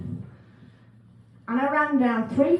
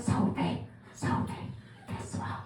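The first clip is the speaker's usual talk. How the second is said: hushed urgent whisper; conspiratorial whisper to colleague Sophie; 4 semitones lower, 9 dB quieter than usual. Retold or imitated. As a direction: whisper to a colleague so the caller won't hear.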